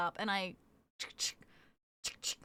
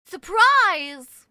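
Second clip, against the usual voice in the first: "Surprise?" shouted, much louder and higher; loud shocked "Surprise?"; 5 semitones higher, 13 dB louder than usual. Shown as a demonstration. Streamer reacting to chat, loud mock shock. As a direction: blurt it out in mock outrage. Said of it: "repeats it loud".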